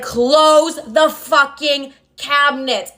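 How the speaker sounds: very slow, word-by-word order in a put-on voice; imitated angry father. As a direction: act out dad at breaking point, stretching each word. Father's final angry order, acted out.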